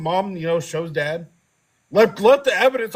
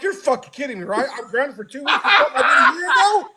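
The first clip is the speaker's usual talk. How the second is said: outraged protest; 8 semitones higher than usual. Retold or imitated. Outraged teen at grounding.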